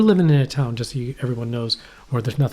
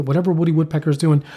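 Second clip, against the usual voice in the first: normal talking voice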